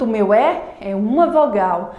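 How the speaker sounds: ordinary talk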